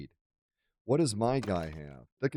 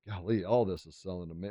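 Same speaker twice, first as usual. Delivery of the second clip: ordinary delivery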